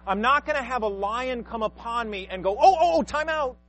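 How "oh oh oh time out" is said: high, loud, hammed-up protest; mock alarm. Preacher playing himself halting a hungry lion, mock alarm. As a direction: call a panicky mock halt, high and loud.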